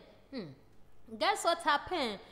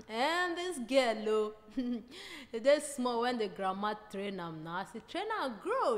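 ordinary delivery

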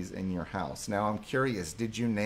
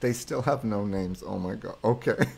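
ordinary talk

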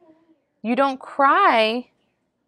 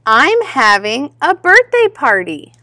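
lively, lilting picture-book narration; read-aloud to children; 2 semitones higher, 5 dB louder than usual. A narrator's reading. Reader tells children about little Rabbit's party, animated storytelling. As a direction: read it out expressively for little listeners.

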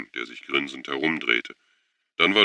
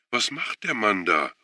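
upset call; 6 semitones higher than usual. A narrator's reading. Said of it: "rief Schuwan aufgebracht".

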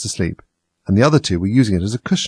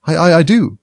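stammering, flustered, raised; hasty reply; 8 semitones higher, 6 dB louder than usual. A narrator's reading. Alice hurriedly defends herself, flustered stammer. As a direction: trip over the words in a rush. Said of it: "Alice hastily replied".

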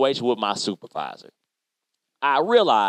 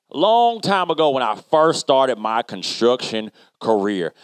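ordinary talk

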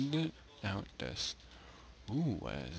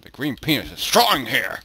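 excited exclamation; 6 semitones higher, 12 dB louder than usual. Real, not acted. Joking about creepers.

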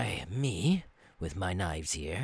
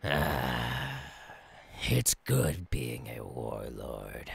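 smug; 9 semitones lower, 4 dB quieter, slower than usual. Acted. Contented villain musing.

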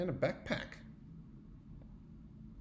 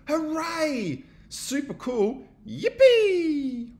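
cheering friends; 12 semitones higher, 8 dB louder, much slower than usual. Acted.

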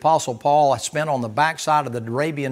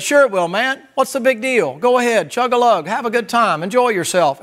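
higher, louder, coaxing tempter; tempting flesh voice; 8 semitones higher, 6 dB louder than usual. Retold or imitated. Flesh voice urging the listener to go ahead and drink, hammed-up high and loud. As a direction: egg him on like a buddy at a party.

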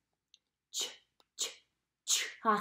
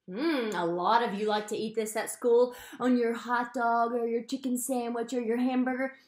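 ordinary talk

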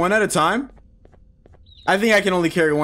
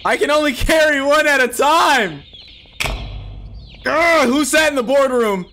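higher-pitched frustrated yelling; exasperated rant; 6 semitones higher than usual. Real, not acted. Frustrated rant at game rule.

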